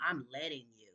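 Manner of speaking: low, soft mock of the ex-husband; mocking her ex. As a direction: drop low and mimic him with scorn. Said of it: "he gonna have the audacity talking about".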